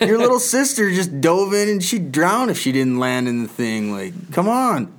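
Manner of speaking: high, incredulous pleading; exasperated goading. Retold or imitated. Dad comparing her to little sister, high exasperated urging. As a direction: push a hesitant kid in mock frustration. Retold goading of hesitant child.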